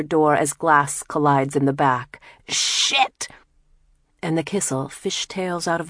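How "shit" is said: sharp, high breathless curse; startled curse. Acted. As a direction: spit it out as glass shatters. Crash, glass collides.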